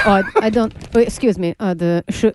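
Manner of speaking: faltering mock Russian newscaster; Russian accent. Newscaster unsure of incoming wire news, halting Russian accent. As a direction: stumble through it in a put-on Russian accent. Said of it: "you do the Russian accent".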